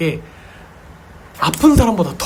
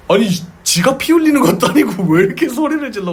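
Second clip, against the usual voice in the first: higher, baffled mock protest; retold startled reaction; 7 semitones higher than usual. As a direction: act out a startled older brother's bewilderment. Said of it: "그러고 깜짝 놀랐대요".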